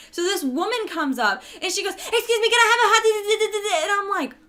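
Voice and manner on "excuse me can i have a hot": high, loud, rattled-off mock order; racing drive-thru order. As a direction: imitate a customer racing through an order. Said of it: "she says it like it's a race".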